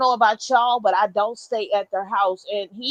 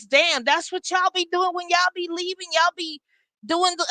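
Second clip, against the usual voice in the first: higher, incredulous "Damn, that's what y'all be doing"; mock-stunned husband, pitched higher; surprised husband; 8 semitones higher than usual. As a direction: sound caught completely off guard. Husband's stunned question, retold.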